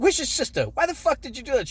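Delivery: high mock-furious mother; squeaky, scolding mom demanding answers; imitating angry mom. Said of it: "She's like".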